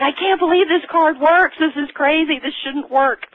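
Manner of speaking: high, amazed voice; disbelief. Retold or imitated. Reps at the blitz, amazed the card works, high and surprised. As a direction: marvel that the card actually works. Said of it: "They're saying".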